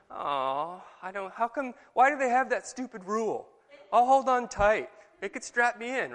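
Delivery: put-on a disappointed child begging to ride; whiny kid. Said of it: "how does that make you feel as you're a child".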